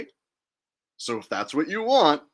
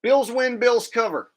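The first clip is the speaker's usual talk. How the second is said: higher, excited repeat of the pick; 8 semitones higher, 4 dB louder than usual. Real, not acted. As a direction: pitch it up, selling the pick. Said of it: "the way I'm going".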